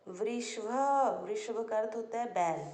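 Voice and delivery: usual speaking voice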